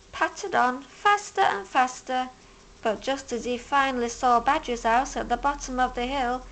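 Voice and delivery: plain reading voice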